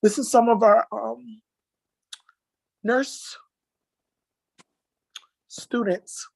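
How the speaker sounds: fighting back tears to get the words out; choked up. Real, not acted. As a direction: voice catching in real tears, long halting pauses. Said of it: "I didn't mean to cry".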